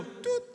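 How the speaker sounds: high squeaky tut of a hung-up call; phone hang-up beep. Pretend. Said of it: "nos vemos luego".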